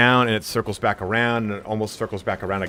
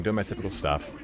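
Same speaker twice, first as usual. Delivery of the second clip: ordinary talk, nothing marked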